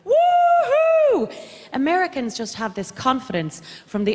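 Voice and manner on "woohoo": high, loud mock-American "woohoo"; long, high celebratory whoop, hammed up; confident American whoop. Comedian imitating American confidence, exuberant high whoop. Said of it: "American-style confidence".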